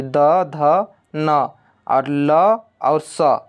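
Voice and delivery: slow, drawn-out "द, ध, न, ल, स"; letter pronunciation demo. Shown as a demonstration. Teacher listing dental letters for students, slowly sounded out. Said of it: "ल का एक बार उच्चारण करिएगा".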